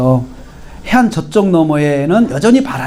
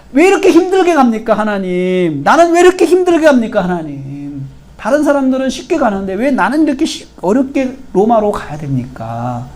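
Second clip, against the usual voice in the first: complaining; 5 semitones higher than usual. Acted. Preacher acts out a believer asking God why it is so hard, complaining. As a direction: wail a mock grievance at God. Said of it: "이렇게 불평할 수 있죠".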